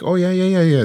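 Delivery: quick high-pitched mock reassurance; brushing off concern. Guest telling her worried friend she's fine, brushing off concern.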